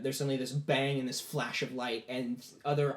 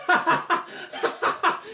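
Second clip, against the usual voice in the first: high, loud villainous laugh; loud acted laugh; 7 dB louder than usual. Boy triumphing as knights are pulled in, loud laugh. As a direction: laugh out in separate, forceful bursts. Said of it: "the boy starts laughing".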